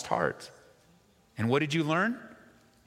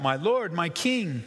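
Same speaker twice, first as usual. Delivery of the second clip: raised-pitch call, only mildly projected; crying out; 6 semitones higher, slower than usual. Retold or imitated. David hailing Saul outside the cave, crying out. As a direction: call out reverently to a king, lightly acted. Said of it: "cries out".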